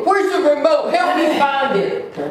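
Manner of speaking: high, loud panicked plea; imitating a panicked husband hunting the remote; frantic husband. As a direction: demand it in a frantic rush.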